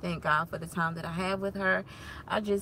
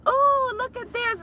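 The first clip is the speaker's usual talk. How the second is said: high-pitched imitation of a delighted toddler; louder mock wonder, way up high; child's delight; 4 dB louder than usual. Toddler to mom at a bed on the floor, delighted.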